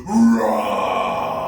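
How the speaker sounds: long, low, loud roar; loud imitation dragon growl-roar. Shown as a demonstration. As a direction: roar out dragon fire for kids. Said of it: "breathe in and go".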